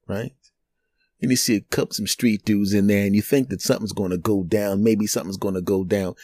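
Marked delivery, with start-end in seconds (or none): none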